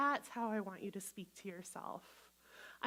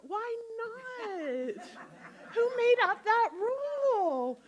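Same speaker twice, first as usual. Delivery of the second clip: high, loud disbelief with a laugh; playful outrage, high and loud; incredulous; 10 semitones higher, 6 dB louder than usual. Real, not acted. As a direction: laugh off the silly rule. Mocking a silly rule.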